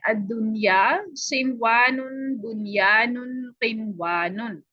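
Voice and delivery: each word drawn out slowly, syllable by syllable; slow recitation. Shown as a demonstration. Teacher slowly reciting the words to memorise. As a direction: recite the four Quran words slowly for students. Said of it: "सिर्फ यही आपने बस याद कर लेना है".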